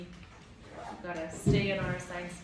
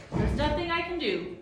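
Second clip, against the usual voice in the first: firm; 8 semitones higher, 7 dB louder than usual. A narrator's reading. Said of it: "said the Proc firmly".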